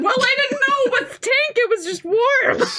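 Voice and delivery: very high, wailing excuse; mock-distraught protest. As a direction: protest your innocence in a squeal. Acted protest at being accused.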